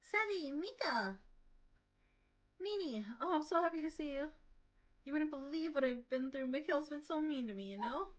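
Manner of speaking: put-on high, gushing girly voice; sugary girl voice. Nini greeting the pair, gushing and clinging. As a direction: coo at the boys like a clingy sweetheart.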